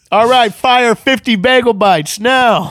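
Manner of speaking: high, loud slow call; mock chef order. Chef calling out an order, high loud mock call.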